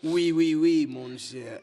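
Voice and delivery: loud, high mock French accent. Joking about a French letter writer, mock French accent. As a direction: play a hammy Frenchman, loud and drawn out. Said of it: "we got a frog here".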